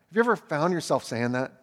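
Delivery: ordinary talk, nothing marked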